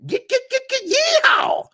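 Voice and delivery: high, loud Southern yeehaw; hammed-up Southern cheer. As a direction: whoop it like a rodeo cowboy. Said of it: "I had to do the Southern accent".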